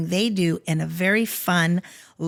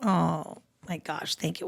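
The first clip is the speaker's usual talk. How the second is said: choked up; 4 semitones lower, 8 dB quieter than usual. Real, not acted. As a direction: thank him through tears. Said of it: "I'm getting teary too".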